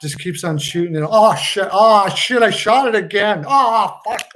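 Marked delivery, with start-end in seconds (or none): 1.1-4.3 higher, louder hammed-up exasperation; hammed-up frustrated outburst, pitched up; mock dismay. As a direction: play a player cursing his own slip. Acting player who keeps shooting by mistake.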